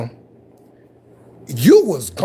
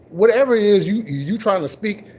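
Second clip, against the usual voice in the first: normal talking voice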